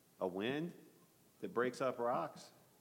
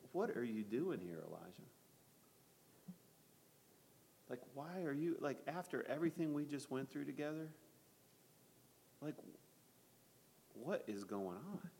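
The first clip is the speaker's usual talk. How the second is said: soft questioning; 2 semitones higher, 8 dB quieter than usual. Acted. God gently questions Elijah.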